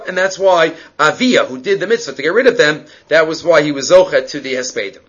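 usual speaking voice

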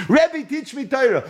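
high, begging plea to the teacher; a hammed-up tearful plea, pitched high; pleading through tears. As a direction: beg like a student about to weep. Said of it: "He starts crying".

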